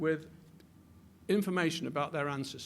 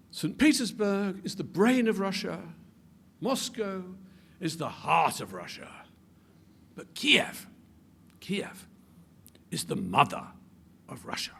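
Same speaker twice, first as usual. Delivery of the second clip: grand, building oratorical quote, dropping to a hush at the end; recited quote; 2 semitones higher than usual. Retold or imitated. Lecturer quoting Tolstoy to his audience, declaiming grandly. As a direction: declaim it like a famous saying, ending almost whispered. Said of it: "Tolstoy has a famous saying".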